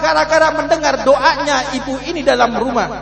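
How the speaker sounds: loud, high preaching at full force; loud preaching climax. Acted. Preacher to his congregation, loud and fervent. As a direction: build it to a loud, rising cry.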